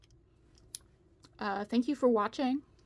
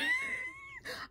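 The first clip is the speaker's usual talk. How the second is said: short high-pitched giggle; 6 dB quieter than usual. Real, not acted. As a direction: burst into a squeaky laugh. Laughing at her own suggestion.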